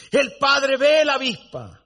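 usual speaking voice

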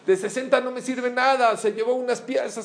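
high, agitated mock panic; shrill-free frantic complaint, pitch way up; frantic outrage. Woman complaining to God about the thief, frantic. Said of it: "Se puso como loca".

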